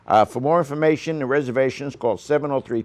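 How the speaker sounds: ordinary talk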